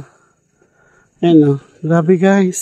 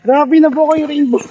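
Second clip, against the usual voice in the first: laughing; 8 semitones higher than usual. Real, not acted. Laughing, delighted at photo.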